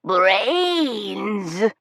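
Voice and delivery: drawn-out zombie groan with a rise; zombie moan. A narrator's reading. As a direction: moan it hungrily. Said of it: "I grumble".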